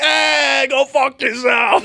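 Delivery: high slow taunt cracking up; laughing taunt. Retold or imitated. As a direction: jeer through laughter. Mock taunt while laughing.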